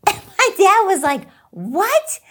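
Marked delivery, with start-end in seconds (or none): 1.5-2.2 a higher, louder startled what; shocked what. Retold or imitated. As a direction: caught off guard, disbelieving. Dad shocked by backwards name.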